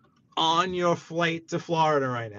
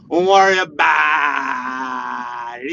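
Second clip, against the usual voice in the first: drawn-out loud teasing; slow sly warning; slow mock menace; 3 semitones lower, 7 dB louder, much slower than usual. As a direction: stretch it like a wiseguy. Teasing viewer Muggsy.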